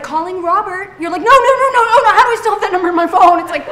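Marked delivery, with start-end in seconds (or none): 0.0-0.9 high, even automated announcement; lightly voiced phone assistant. 1.2-3.4 loud, high, rapid-fire protest; hammed-up alarm, words tumbling out fast; mock panic. Panic as phone dials an ex.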